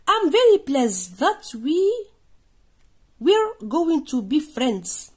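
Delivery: very slow, word by word, I'm very pleased read out; slow dictation reading. Shown as a demonstration. Teacher reading the English text to pupils, very slow. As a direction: read it out slowly so pupils follow. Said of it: "reading the text to class".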